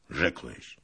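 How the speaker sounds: understated gruff grumble; grunted reply. A narrator's reading. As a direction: grumble it back curtly. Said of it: "burknął Tuchaj Bey".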